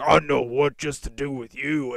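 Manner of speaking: loud mock-menacing teacher voice; imitated threatening teacher. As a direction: act out a teacher grabbing a pupil in anger. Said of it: "He grabbed me by the shirt".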